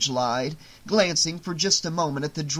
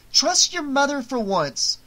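begging; 11 semitones higher, 3 dB louder than usual. Acted. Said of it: "Marge begged".

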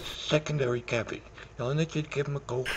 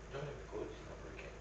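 rushed quiet mumble; 3 semitones lower, 17 dB quieter, much faster than usual. Acted.

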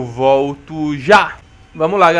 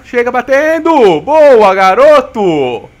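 loud, high, slow cheer; excited cheer; 5 semitones higher, 8 dB louder, much slower than usual. Real, not acted. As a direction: roar it for your teammate. Cheering a teammate's shot.

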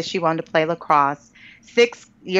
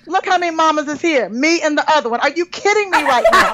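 high, fast hammed-up outrage; offended ego ranting, playful; mock indignation; 8 semitones higher, 3 dB louder, faster than usual. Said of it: "Girl, my ego was like".

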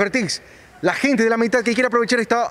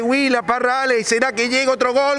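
usual speaking voice

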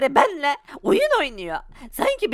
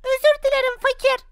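high, meek apology; high child voice; 11 semitones higher than usual. Acted. Scolded child apologizing to father, high voice. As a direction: squeak out a sorry.

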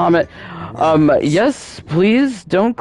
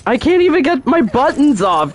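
higher, exasperated complaint; 9 semitones higher than usual. Real, not acted. Gamer complains her buttons won't register, higher and fed up.

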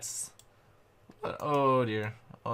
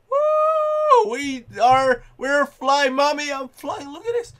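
high, excited squeals of joy; thrilled whoop; 10 semitones higher, 4 dB louder, slower than usual. Real, not acted. Gamer thrilled at flying in game.

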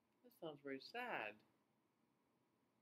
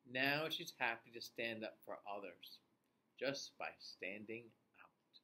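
story reading; 5 semitones lower than usual. A narrator's reading. Reads story to children.

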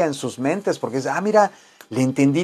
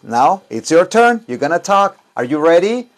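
louder, upbeat call to the class; energetic teacher; 3 semitones higher, 3 dB louder than usual. Shown as a demonstration. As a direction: pump the students up. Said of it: "repitan por favor". (animando a los alumnos a hablar)

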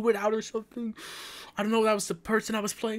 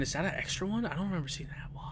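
quick frightened whisper; 11 dB quieter, faster than usual. Real, not acted. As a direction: breathe it out, scared. Spooked player in horror game.

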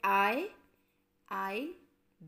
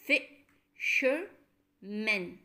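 Fishermen spoken very slowly, each syllable pulled apart; 3 dB louder, much slower than usual. Shown as a demonstration. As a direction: stretch Fishermen into its three parts. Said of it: "So it has three syllables".

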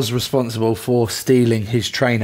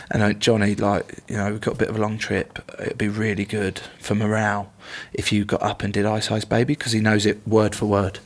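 ordinary talk, nothing marked

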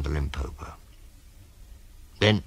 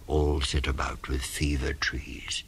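ordinary delivery, nothing marked

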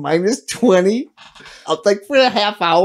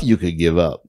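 gloomy inner voice; 13 semitones lower than usual. Retold or imitated. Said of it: "you just say to yourself".

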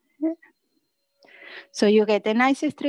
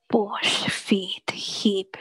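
ordinary talk

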